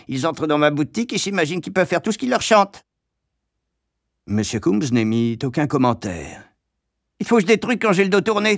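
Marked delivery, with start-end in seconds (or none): 0.1-2.8 fast, high grumbling shopkeeper; Madame Pratchett. A narrator's reading. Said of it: "marmonnait Madame Pratchett". 7.2-8.6 high, fast complaining shopkeeper; Madame Pratchett. A narrator's reading. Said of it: "poursuivit-elle".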